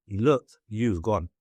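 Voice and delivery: ordinary talk, nothing marked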